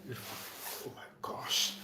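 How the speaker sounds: slow, hushed whisper of relief; whispered sigh. Pretend. Concertgoer relieved by music, slow whispered oh my gosh.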